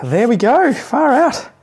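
high, lifted wrap-up cheer; relieved exclamation. Real, not acted. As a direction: let it out high as the job's done. Exclaims on finishing the job.

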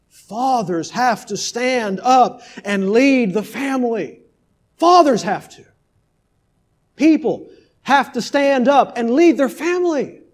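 high, loud, slow exhortation; preaching at full force. Acted. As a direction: hammer it home to the fathers. Exhorts fathers from pulpit.

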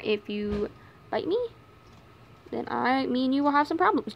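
normal talking voice

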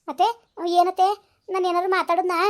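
defensive loud reply. Acted. Daughter-in-law denying to her mother-in-law, loudly. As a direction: fire back innocently but loud. Denies shouting, raised voice.

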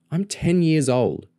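high, slow, proud declaration; proud kid standing up for themself. A narrator's reading. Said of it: "Lauren said proudly".

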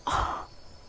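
sharp voiceless gasp; shocked gasp. Real, not acted. Player reacts to game scare, shocked gasp. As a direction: suck in breath in shock.

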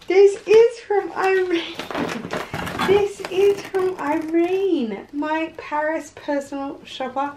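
high-pitched, thrilled announcement; real excitement. Real, not acted. Vlogger showing a gift from her Paris shopper, excited. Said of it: "I'm so excited".

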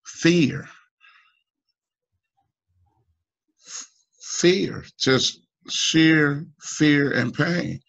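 real anguish, pitch jumping up on each word; halting, high and heavy with pain. Real, not acted. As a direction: let the hurt push the voice up. Anguished over his daughter's fear.

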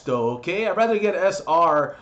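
normal talking voice

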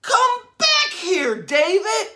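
high, slow shouted call after her son; mom screaming. Acted. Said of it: "his mom was screaming".

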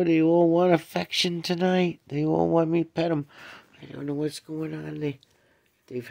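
high, loud cooing at the cats; excited high cat talk. Real, not acted. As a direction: gush over the cats in a sing-song pet voice.

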